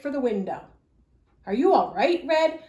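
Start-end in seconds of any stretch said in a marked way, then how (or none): 1.5-2.6 loud, worried call. A narrator's reading. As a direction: call across the room to her. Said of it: "called Grandma".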